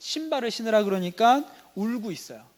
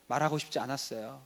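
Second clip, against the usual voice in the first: reluctant son; 4 semitones lower, 4 dB quieter than usual. Acted. Son's reluctant answer acted.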